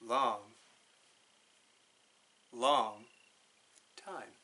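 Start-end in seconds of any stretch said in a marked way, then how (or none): none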